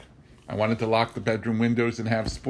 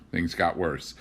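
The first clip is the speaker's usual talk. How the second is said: ordinary delivery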